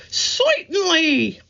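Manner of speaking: high squeaky voice. Acted. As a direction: squeak it out like a goofy comic. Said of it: "he had a high, squeaky voice".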